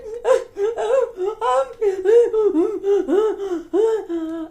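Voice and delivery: high, pulsing boo-hoo; hammed-up wailing in place of an answer; mock sobbing. Bug girl tries to answer Muffy, hammed-up sobbing. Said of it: "why are you crying".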